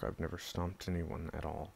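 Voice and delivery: normal talking voice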